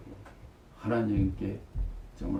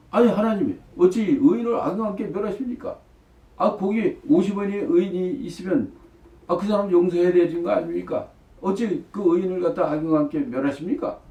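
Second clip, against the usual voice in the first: higher-pitched, imitated remonstrance; bold challenge; 7 semitones higher, 4 dB louder than usual. Abraham demands God spare the righteous, acted bold challenge. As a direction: protest boldly, pressing each question. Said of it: "하나님 앞에 당돌하게 말이야".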